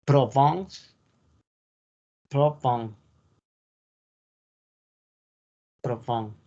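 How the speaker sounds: Provence said provons, French nasal ending; French pronunciation. Shown as a demonstration. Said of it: "La Provenza es". (Provence con pronunciación francesa)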